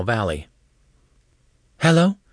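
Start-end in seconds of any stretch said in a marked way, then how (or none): 1.8-2.2 higher, louder phone greeting; high-pitched questioning hello; female voice on call. A narrator's reading. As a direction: answer the call in a higher woman's voice. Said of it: "says a female voice".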